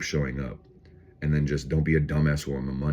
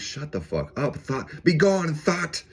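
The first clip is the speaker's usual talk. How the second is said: mock banishing; 12 semitones higher, slower than usual. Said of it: "I'm like".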